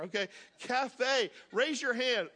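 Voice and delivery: normal talking voice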